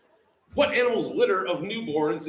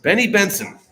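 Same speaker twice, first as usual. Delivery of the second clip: loud, higher-pitched proclamation; emphatic announcement; 5 semitones higher, 9 dB louder than usual. Real, not acted. Host proclaims the quiz answer to players, loud and emphatic. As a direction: belt the name out.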